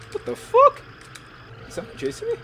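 high, loud frightened cry; panicked yell. Real, not acted. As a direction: yelp in real fear. Frightened gamer being chased.

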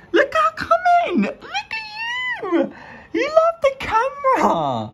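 very high, slow coo with a laugh. Real, not acted. Cooing at her fish that loves the camera. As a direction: gush at the fish.

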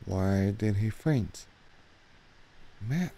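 ordinary talk, nothing marked

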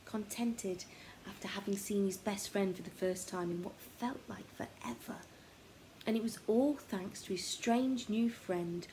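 ordinary delivery

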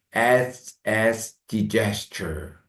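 에스 drawn out, then digester very slowly; slow demo. Shown as a demonstration. Teacher slowly reviewing digester. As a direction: stretch 에스 then the word. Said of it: "에스터로 출발해서요".

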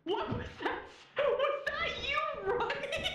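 high-pitched, laughing fright; startled panic. Real, not acted. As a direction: squeal it in a half-laughing panic. Startled by a noise in game.

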